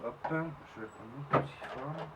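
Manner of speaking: trembling nervous voice; shaky with nerves. Real, not acted. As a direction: voice shaking with jittery excitement. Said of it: "je tremble de partout".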